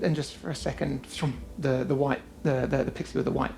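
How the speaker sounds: usual speaking voice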